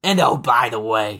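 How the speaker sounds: laughing through a New York accent. Shown as a demonstration. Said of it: "you need to say it in that New York accent".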